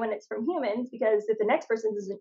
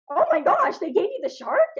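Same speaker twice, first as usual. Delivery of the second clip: amazed; 7 semitones higher, 3 dB louder than usual. Retold or imitated. Someone amazed at her shark.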